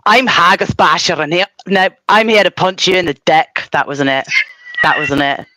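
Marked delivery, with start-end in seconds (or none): 0.1-3.7 hammed-up Scottish superhero; caricature Scottish accent. Streamer performs Haggis Basher for a viewer, caricature Scottish accent. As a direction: put on an exaggerated Scottish accent, fumbling slightly. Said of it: "this is a caricature".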